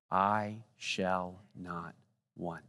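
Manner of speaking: fading hush. Shown as a demonstration. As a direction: let "I shall not want" fade away slowly. Closing recitation, fading.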